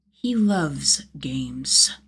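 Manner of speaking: very slow, unvoiced menace; slow sinister whisper; menacing whisper. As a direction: savor the threat. Jason's vicious thought.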